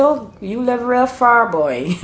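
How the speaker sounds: put-on a touch of Bajan accent, teasing. Bajan neighbour teasing about living far, light Bajan accent. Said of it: "they say".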